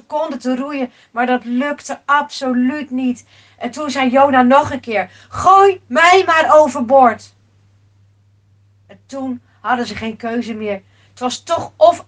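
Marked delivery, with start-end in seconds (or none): 5.3-7.3 forceful voice, much louder; loud insistent command. Acted. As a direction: insist on it loudly. Jona repeats his demand. (luid en nadrukkelijk aandringen)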